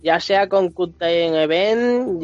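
ordinary delivery

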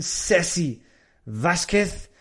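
ordinary delivery

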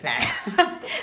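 teasing laugh. Real, not acted. Teasing question about dying.

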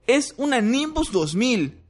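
put-on high young boy's voice; boastful boy. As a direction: brag it to his rival. Ron boasting to Malfoy.

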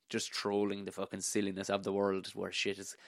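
ordinary delivery

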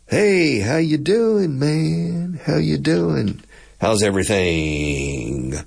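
slow, high Texas drawl sinking at the end; drawled English greeting. Shown as a demonstration. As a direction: stretch every vowel like a friendly Texan. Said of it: "it's just totally different approach".

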